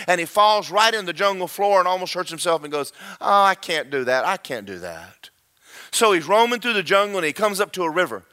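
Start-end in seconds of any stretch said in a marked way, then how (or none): 3.2-5.3 low, deflated whine trailing into softness; dejected little creature. Acted. Creature gives up after falling.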